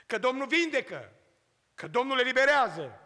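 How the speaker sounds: fervent sermon cadence; preaching. Acted. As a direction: proclaim it from the pulpit, building. Preacher proclaims at full force.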